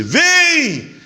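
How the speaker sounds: high ringing call to come; Jesus calling Pedro across the water, a sudden high call. Acted.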